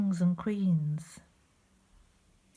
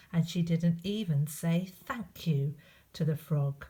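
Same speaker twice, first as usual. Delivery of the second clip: ordinary delivery, nothing marked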